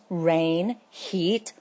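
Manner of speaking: ordinary delivery, nothing marked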